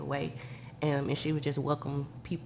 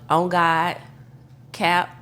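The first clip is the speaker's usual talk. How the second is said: slang; 8 dB louder, much slower than usual. Shown as a demonstration.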